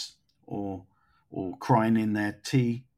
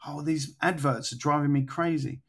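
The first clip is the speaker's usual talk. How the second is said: normal talking voice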